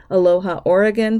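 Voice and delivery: ordinary talk, nothing marked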